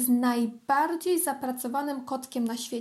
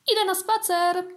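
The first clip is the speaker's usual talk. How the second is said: higher, louder call out; 7 semitones higher, 5 dB louder than usual. Acted. Kitten calls out to the house that he's going for a walk, louder and higher. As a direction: shout it over the shoulder. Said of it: "zawołał".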